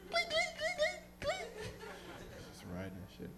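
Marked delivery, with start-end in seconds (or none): 0.1-1.5 high squeaky clinking. Retold or imitated. Imitating bike noise.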